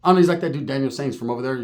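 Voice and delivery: normal talking voice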